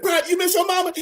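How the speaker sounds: mock the private's complaint; mocking drill sergeant.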